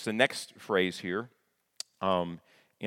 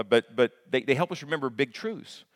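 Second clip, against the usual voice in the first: normal talking voice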